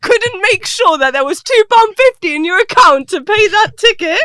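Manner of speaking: high, loud, laughing disbelief; laughing rant. Real, not acted. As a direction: rant while cracking up. Mocking the couple, laughing.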